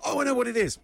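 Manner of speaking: high, excited imitation of recognition; light up as the answer hits; mock sudden realisation. Imitating a listener guessing the clue, high mock realisation.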